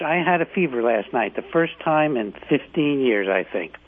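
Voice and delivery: usual speaking voice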